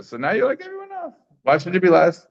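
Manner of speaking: incredulous why-should-he-lose rhetorical push; rising indignant question. Real, not acted. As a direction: push the question as if it's unfair. Teacher pressing a rhetorical question.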